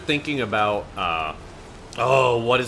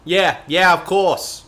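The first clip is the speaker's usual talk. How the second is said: higher, louder Boston drawl; Boston accent; 7 semitones higher, 8 dB louder, slower than usual. Retold or imitated. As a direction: toss off of course in Boston style. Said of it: "Boston accent".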